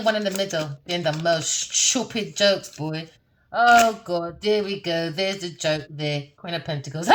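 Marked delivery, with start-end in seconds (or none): none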